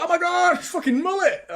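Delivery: high, loud mock gasp of recognition; mock excited crowd. Imitating the chat seeing Mullet arrive, mock excited. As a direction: play the whole chat freaking out. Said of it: "Everyone's like".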